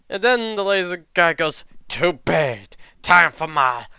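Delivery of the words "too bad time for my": hammed-up attack call, stretched out; slow, loud, theatrical battle cry; exaggerated showboating fighter. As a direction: announce your special move with over-the-top drama. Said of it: "Like, he's exaggerated it".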